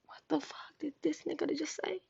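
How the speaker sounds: breathy disbelieving whisper to himself; shocked whisper. Retold or imitated. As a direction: hiss it under your breath in shock. Inner thought, shocked.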